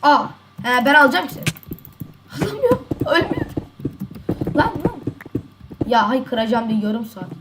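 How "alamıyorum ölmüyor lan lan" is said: high squeaky cries, voice cracking up; frustrated squeal. Real, not acted. Gamer squeals high when the enemy just won't die.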